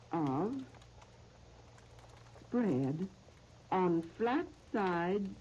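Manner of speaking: slow, measured phrases with long pauses; recipe dictation. Real, not acted. Radio host reading out a recipe to listeners, slow dictation. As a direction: dictate it piece by piece, leaving time to write.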